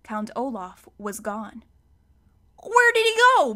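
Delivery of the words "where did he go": high, loud panicked shout. A narrator's reading. Mister Poe shouting as the villains vanish. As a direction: yell it out in alarm. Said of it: "Mister Poe shouted".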